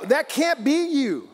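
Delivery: rebuking timid believers with a slow shout. Acted. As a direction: bellow each word at them, slow. Preacher rebuking the fearful.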